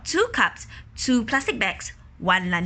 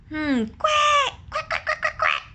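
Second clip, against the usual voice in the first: duck quacks. Acted. Ducks quacking.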